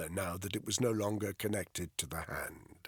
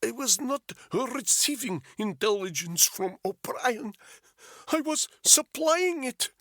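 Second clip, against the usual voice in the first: put-on high, insistent Russian-accented English, rising louder; thick Russian accent; 14 semitones higher, 5 dB louder than usual. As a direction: press the question in a heavy Russian accent. Polyakov voiced throughout.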